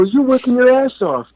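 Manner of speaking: high-pitched exasperated retold rant; heated rant. Arguing with his actor friend about auditions, exasperated high rant. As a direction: vent it like a drunk street argument. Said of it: "And I go".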